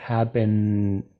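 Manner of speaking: "Happen" with the N hummed at the end; held final N. Shown as a demonstration. Teacher checking how the verb ends, held humming N. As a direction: prolong the voiced N of "Happen". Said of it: "termina en N".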